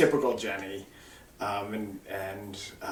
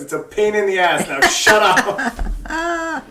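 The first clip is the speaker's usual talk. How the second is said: dad's gruff retort, laughing through it and much higher; laughing mock shout; 8 semitones higher, 8 dB louder, slower than usual. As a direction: burst out the punchline, cracking up. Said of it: "And he goes".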